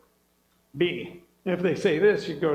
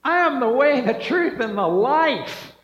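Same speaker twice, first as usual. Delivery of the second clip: higher and louder, ringing declaration; proclaimed scripture; 5 semitones higher, 6 dB louder than usual. Acted. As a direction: proclaim it to the whole room. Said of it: "John fourteen six".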